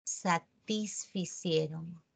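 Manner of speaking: slow, soft "Satisficieron" said syllable by syllable; model answer. Shown as a demonstration. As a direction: spell out "Satisficieron" quietly and slowly. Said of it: "¿cómo sería?".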